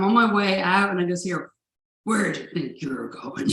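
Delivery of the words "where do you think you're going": menacing dad. Retold or imitated. The aggressive dad catches her leaving.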